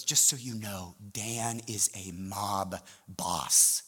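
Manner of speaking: low, soft, slow warning; hushed confiding. Retold or imitated. Staff member warns the new leader about Dan, hushed. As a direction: confide it like a grave secret, drawing out the end. Colleague confides a grave secret.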